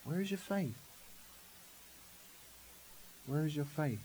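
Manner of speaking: a hushed, disappointed question; gentle rebuke. Retold or imitated. Jesus softly asking his disciples, very quiet.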